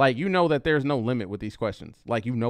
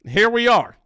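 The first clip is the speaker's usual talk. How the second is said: higher, louder, wry here we are; mock resigned; 7 semitones higher, 5 dB louder than usual. Real, not acted. Resigned reaction to clip.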